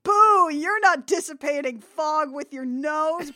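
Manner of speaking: loud jeering boos; booing crowd. Retold or imitated. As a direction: jeer like an angry audience. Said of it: "they're like".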